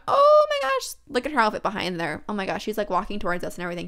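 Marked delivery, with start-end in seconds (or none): 0.1-0.9 voice cracking up. Real, not acted. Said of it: "I don't know what happened to my voice a few seconds ago".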